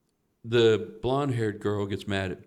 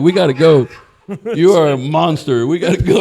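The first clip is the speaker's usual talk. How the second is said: loud, alarmed shout to get out now; urgent yell; 6 semitones higher, 14 dB louder than usual. Retold or imitated. Fleeing a smelly swingers room, shouting in alarm. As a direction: yell at your buddy that you're leaving. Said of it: "And I was like".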